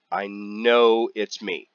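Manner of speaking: ordinary talk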